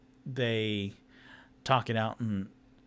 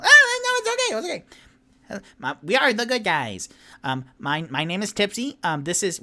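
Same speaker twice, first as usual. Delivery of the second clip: high squeaky reassurance; 9 semitones higher, 7 dB louder than usual. Acted. Tipsy reassuring family.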